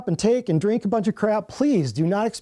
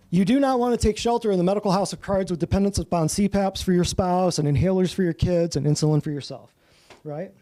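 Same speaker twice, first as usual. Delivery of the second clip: ordinary delivery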